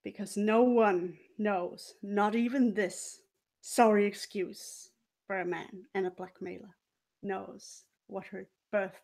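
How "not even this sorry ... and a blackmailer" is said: usual speaking voice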